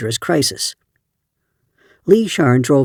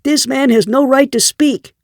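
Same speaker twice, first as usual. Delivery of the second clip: much higher, indignant outburst; angry cry; 11 semitones higher than usual. A narrator's reading. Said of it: "Gordon cried".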